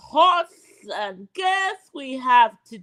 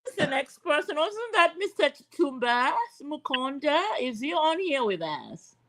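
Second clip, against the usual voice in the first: ordinary talk, nothing marked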